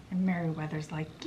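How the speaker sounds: ordinary delivery, nothing marked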